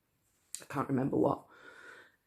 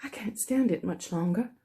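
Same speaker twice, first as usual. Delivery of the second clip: breathless Tom; 6 semitones higher than usual. Acted. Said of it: "in short catches between breaths".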